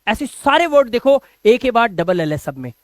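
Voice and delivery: ordinary talk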